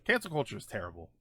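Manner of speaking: normal talking voice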